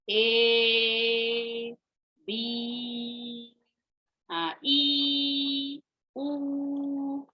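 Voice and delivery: each of ए, बी, ई, ऊ stretched slowly; long sounds. Shown as a demonstration. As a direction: hold ए, बी, ई, ऊ out long on a level note. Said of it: "ऐसे long sounds बोलते हैं". (ए, बी, ई, ऊ को लंबा खींचकर बोलना)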